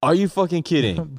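loud, high-pitched incredulous outburst; outraged disbelief. Real, not acted. Fan hearing the team just won, outraged disbelief. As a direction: blurt it out in shocked disbelief. Reacting to surprise game result.

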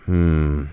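low pondering hum; thinking hmm. Real, not acted. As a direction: hum while deciding. Deciding on a pick.

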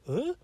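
a short rising "Huh?"; English question ending. Shown as a demonstration. Explaining how English questions end, rising intonation. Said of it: "English sentence that's a question".

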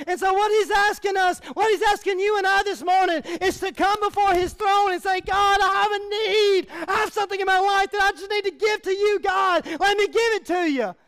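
high, loud preaching, slowing on key words; full-force preaching. Acted. Pastor preaching loudly to the congregation on God's gifts. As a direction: press the message home to the congregation.

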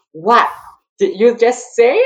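slow down in offended astonishment; mock disbelief. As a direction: imitate being stunned by the coach's answer. Retold disbelief at coach's refusal.

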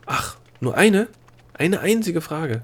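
high, slow questioning repeat; surprised echo. Acted. Princess hearing there is only one question, surprised and relieved.

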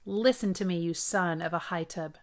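plain reading voice

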